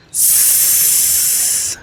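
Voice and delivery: long hissed "s"; sustained soft "s" without throat vibration; unvoiced s sound. Shown as a demonstration. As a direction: hold "s" as a hiss, no voice. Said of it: "there is no vibration".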